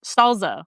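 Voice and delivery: "Salsa" said as "sol-zuh"; imitated "Salsa" with a Z; dad's SALSA. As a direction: put a buzzing Z in "Salsa". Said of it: "like, with a Z".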